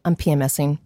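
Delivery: flat admission. Retold or imitated. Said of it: "stopped the ranting and go".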